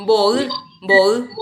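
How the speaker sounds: put-on boy, boy dicho fuerte y marcado, como modelo; pronunciación correcta. Shown as a demonstration. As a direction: pronuncia boy claro y fuerte para el alumno. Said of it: "No, dice". (la profe corrige y muestra boy en voz alta)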